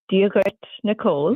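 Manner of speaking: "Dia Gret Nicole" said as an Irish-language greeting, lightly lilting; greeting in Irish Gaelic. Shown as a demonstration. Greeting the host in Irish on request. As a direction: switch into Irish for the hello. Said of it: "do you want to do it".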